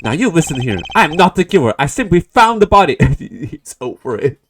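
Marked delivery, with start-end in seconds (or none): 0.0-3.0 loud, firm protest; accused prosecutor denying the murder loudly; indignant Edgeworth. Acted. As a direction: insist on innocence. Edgeworth defends himself loudly.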